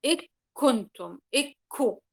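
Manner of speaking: "إك كنتم" slow and loud, the nun swallowed into k; wrong merged nun. Shown as a demonstration. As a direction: stretch out "إكو" with the nun merged into the kaf. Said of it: "سأدغم النون عند الكاف". (إدغام خاطئ بصوت عالٍ وبطيء)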